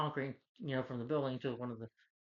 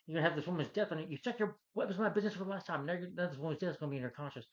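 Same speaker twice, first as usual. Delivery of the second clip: fast, higher, mock accusing rant; villain rant; 5 semitones higher than usual. Recapping the movie, the villain rants at Aunt May, fast and higher. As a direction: rattle it off like an outraged villain. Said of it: "he's like".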